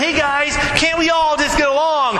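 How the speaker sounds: high, pleading peacemaker, near a shout; mock-innocent plea, rising to a shout; peacemaking Moses. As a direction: step in and calm two brawlers, pitched well up. Moses breaks up a fight.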